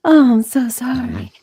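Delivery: breathy whispered "so sorry"; whispered apology. Pretend. Guest whispers a mock apology to the host. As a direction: whisper it like a scolded kid.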